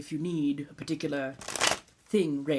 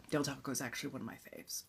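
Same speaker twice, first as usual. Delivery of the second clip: quick whisper; 4 semitones lower, 10 dB quieter, faster than usual. Real, not acted. Quick excited food whisper.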